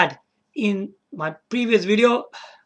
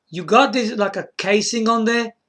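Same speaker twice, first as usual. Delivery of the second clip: usual speaking voice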